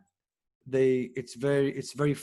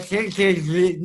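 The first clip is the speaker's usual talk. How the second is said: put-on a light Israeli accent, a bit higher and louder; 5 semitones higher, 6 dB louder than usual. Actor showing friends how Israelis sound, light Israeli accent. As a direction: toss it off in a mild Israeli accent. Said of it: "Nobody understands why do we talk that way".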